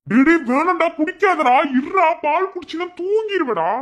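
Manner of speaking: high, loud frantic pleading; panicked pleading. Acted. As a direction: beg him to stop, panicking. Stopping friend drinking the milk. (பதறிய கெஞ்சல்)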